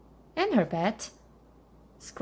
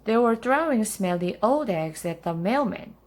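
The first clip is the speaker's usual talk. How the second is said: usual speaking voice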